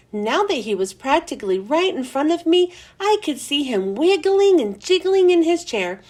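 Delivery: ordinary delivery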